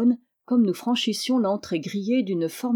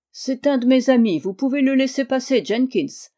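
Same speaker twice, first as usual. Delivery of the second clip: higher, louder call to the guard; 5 semitones higher, 5 dB louder than usual. A narrator's reading. Malone calling out to the guard Jenkins to let his friend in. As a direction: call across the gate to stop him. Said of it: "cria Malone".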